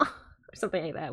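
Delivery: ordinary delivery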